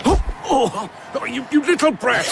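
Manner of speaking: sputtering, then shouting at the brat; startled scolding. Acted. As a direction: snap at the child who collided with him. Child runs into official.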